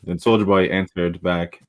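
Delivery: ordinary delivery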